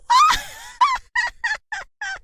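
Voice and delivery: high, loud shrieking witch cackle; evil cackle. Acted. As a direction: shriek with wicked laughter. Witch laughing after threat. (ऊँची तीखी चुड़ैल की हंसी)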